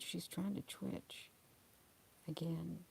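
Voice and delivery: hushed. Real, not acted. Watching the sleeping dog.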